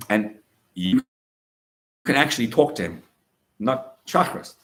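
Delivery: ordinary talk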